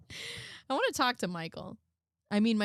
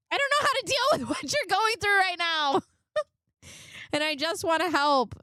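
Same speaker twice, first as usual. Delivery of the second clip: much higher mock plea, laughing through it; mock pleading girlfriend; 8 semitones higher than usual. Reading Laura's pleading text to Michael in a high mock voice. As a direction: overplay the helpless plea.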